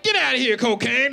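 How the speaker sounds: loud high shooing; mock refusal. Drinker shouting high at cocaine to leave. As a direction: push cocaine away in a high yell. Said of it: "He's like".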